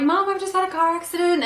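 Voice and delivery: high, panicky report to mum; retold panic on the phone; panicked call. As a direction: blurt it out freaking out. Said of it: "freaking out".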